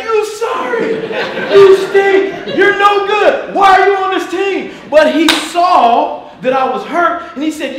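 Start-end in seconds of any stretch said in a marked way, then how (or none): 0.0-4.7 much higher and louder mock yell; mock shouting teammate. Imagined Eddie yelling at the hurt receiver, mock shout. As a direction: bellow it like a furious teammate. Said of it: "And Eddie's like".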